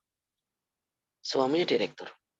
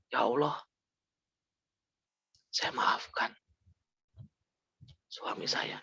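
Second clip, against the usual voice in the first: breathy, tearful whisper, much softer; tearful prayer; 7 dB quieter, slower than usual. Retold or imitated. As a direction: forgive through tears, barely voiced. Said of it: "Dia nangis".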